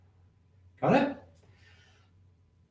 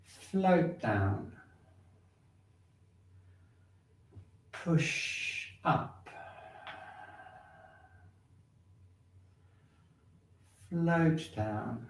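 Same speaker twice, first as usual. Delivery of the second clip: slow, drawn-out qigong cues, growing softer; slow guided movement cues; 11 dB quieter, much slower than usual. Acted. Qigong teacher guiding students through sinking and floating, slow and soft. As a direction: stretch each cue like a slow tide.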